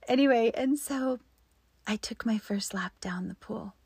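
usual speaking voice

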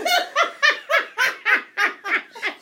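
long high loud laughter; hard laugh. Real, not acted. Mother cracking up at her daughter's outburst, real laughter. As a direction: laugh until you can't talk.

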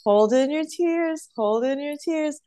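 high, slow self-coaxing, imitating inner voice; retold plea to oneself, pitched high and drawn out; holding back tears. Injured dancer telling herself not to cry on stage, retold holding back tears. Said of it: "I'm just trying not to cry".

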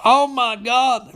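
high, drawn-out exclamation; mock shock. Recapper reacting to the phone call, mock-shocked. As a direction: wail it in hammed-up disbelief.

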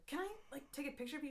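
high, soft asking voice; tentative retold request. Friend asking a star for a photo, tentatively. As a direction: ask sweetly, small and high.

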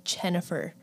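"Chennifer" with a hard CH opening; Mennonite pronunciation. Shown as a demonstration. Granddaughter quotes her grandparents, "Chennifer" with a Mennonite CH. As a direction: say "Chennifer", CH in place of J. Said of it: "they would call me Chennifer".

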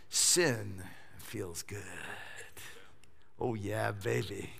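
low, soft, slow, indulgent croon; seductive tempter. Pretend. Preacher mimicking how sin tempts, low and slow. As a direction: purr it like temptation itself.

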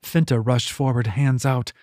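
ordinary delivery

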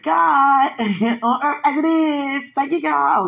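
high, loud thanks with a laugh; acted grateful turnaround. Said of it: "Went from having tantrums to like".

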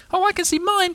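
very high little-creature voice; Roo crying out. A narrator's reading. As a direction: squeal it out eagerly as Roo. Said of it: "cried Roo".

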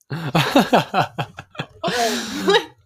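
laughing. Real, not acted. Sister correcting her slip on the show, laughing. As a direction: struggle slowly through a real giggle fit. Giggling after slip of the tongue.

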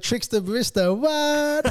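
loud, high sign-off holler; catchphrase shout. Acted. Host signing off the podcast, shouted catchphrase. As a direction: holler the tagline, soaring on the last word.

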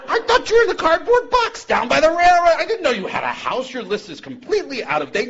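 high, loud, teasing mock surprise; God feigning shock at the drunk's house; mock-surprised God. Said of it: "He says".

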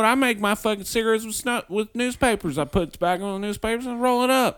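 high, loud hammed-up old man voice; mock old man. Mocking the world's oldest smoker for her friend in a high, loud voice. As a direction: play up the old smoker's cheerful complaint.

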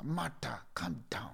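calm her down in a hush; soothing whisper. Retold or imitated. Jesus hushes a fretting Martha with a gentle whisper.

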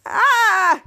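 high, loud shriek of horror; hammed-up horrified "ah"; mock scream. Retold scream at the sight.

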